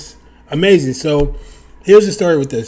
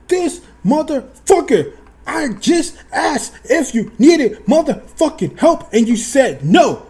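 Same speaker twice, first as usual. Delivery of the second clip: high, loud exasperated rant in his head; furious thought, half yelled; angry inner rant; 11 semitones higher, 4 dB louder than usual. Retold or imitated. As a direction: fume inside, voice climbing. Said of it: "In my head, so quick".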